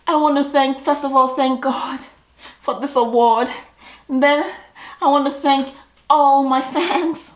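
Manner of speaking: high, hammed-up winner's speech; mock acceptance speech. Mocking the girls as award winners, hammed-up speech. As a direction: gush like an overdone award winner. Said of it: "And the Academy Award goes to".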